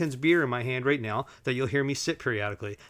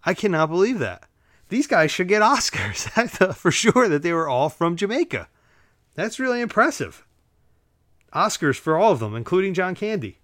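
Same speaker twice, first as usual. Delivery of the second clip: higher-pitched, amazed and emphatic; stunned disbelief; 5 semitones higher, 4 dB louder than usual. Real, not acted. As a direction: marvel at it, voice lifting. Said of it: "I was stunned by that".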